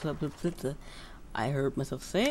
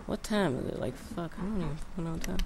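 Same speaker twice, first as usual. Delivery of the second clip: normal talking voice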